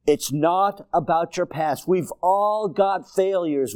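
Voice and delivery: normal talking voice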